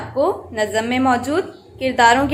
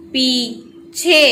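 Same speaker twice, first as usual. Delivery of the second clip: पीछे drawn out slowly in two parts; teacher blending पीछे slowly; syllable by syllable; much slower than usual. Shown as a demonstration. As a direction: sound out पीछे with a gap between syllables. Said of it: "पे".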